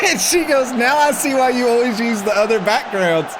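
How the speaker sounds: can barely get the story out for laughing; real giggling while telling it. Real, not acted. Retelling embarrassing call, laughing.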